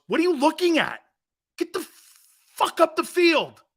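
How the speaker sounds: high-pitched, irritated coach imitation; berating coach. Coach to assistant sitting at the back, berating him. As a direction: chew him out, drawing out the last order.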